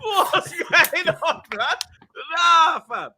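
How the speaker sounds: slow, high laughing drawl. Real, not acted. Mocking flashy uncles to friends, high laughing. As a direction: stretch it out through laughter.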